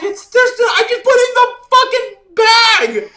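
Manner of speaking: high, very loud retort; exasperated yelling. Retold or imitated. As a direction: yell back in total exasperation. Yelled reply in retold quarrel.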